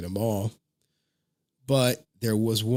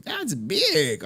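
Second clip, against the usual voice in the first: high-pitched child imitation; amazed kid; 7 semitones higher than usual. Daughter seeing dad's huge campus, amazed high voice. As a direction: mimic an impressed little girl.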